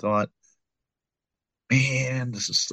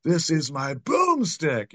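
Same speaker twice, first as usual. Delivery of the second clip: slow, higher movie-hero line; a slow, higher boomstick boast; Ash impression; 7 semitones higher, much slower than usual. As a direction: ham up Ash's boast slowly. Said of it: "in Army of Darkness you got".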